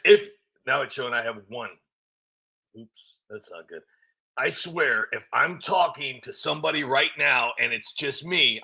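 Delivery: usual speaking voice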